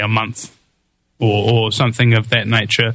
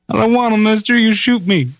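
higher pleading voice, begging; scared sheep herder; 8 semitones higher than usual. Retold or imitated. Cornered sheep herder begs the gunman, higher and pleading. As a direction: beg the gunman not to make you draw. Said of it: "the sheep herder's feet".

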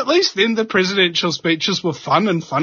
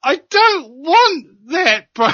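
reluctant refusal; 8 semitones higher, 3 dB louder, slower than usual. Retold or imitated. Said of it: "the one guy in the crowd is going".

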